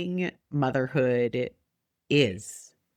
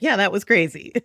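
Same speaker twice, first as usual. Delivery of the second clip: higher, louder wholehearted agreement; emphatic agreement; 6 semitones higher, 6 dB louder than usual. Acted. Fellow parent confirming the shared experience, emphatic agreement. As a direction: agree eagerly, raised and loud. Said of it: "They're like".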